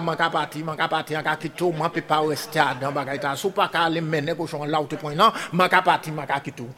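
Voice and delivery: low, soft, running complaint; wife announcing she leaves. Acted. Wife to Loaden, threatening to leave, in a low voice. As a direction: drop low and quiet as the wife gives notice. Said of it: "Madame là dit Loaden".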